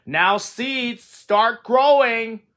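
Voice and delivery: calling out slowly to the seeds; loud call. A narrator's reading. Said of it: "said loudly".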